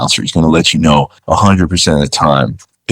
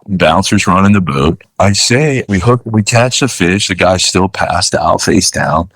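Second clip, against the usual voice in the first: ordinary delivery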